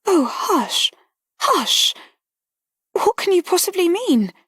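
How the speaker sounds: high, alarmed hushing; startled and anxious. A narrator's reading. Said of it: "She was startled".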